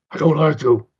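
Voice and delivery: loud put-on hostile voice; mock angry. Angry furry confronts him at the con, mock angry. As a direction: imitate an angry fan, loud and blunt. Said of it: "came in with anger".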